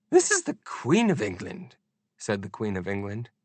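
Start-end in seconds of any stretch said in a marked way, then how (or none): 0.1-1.8 Queen's voice. A narrator's reading. Queen speaking.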